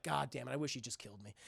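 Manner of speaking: low, soft, quick acted grumble; defeated grumble. Spike lying on the ground, low defeated muttering. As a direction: grumble it under your breath, wishing it were over.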